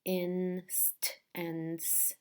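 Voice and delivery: "Inst and s" drawn out slowly as in, st, ance, s; separate sounds. Shown as a demonstration. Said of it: "get confused with all the sounds".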